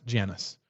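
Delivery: "Janus" pronounced JAN-us; short "a" in the first syllable of "Janus"; American pronunciation. Shown as a demonstration. Host corrects the company name for a viewer, "Janus" as JAN-us. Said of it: "it's pronounced".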